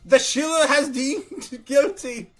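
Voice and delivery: mock royal proclamation. Repeating the mock royal decree.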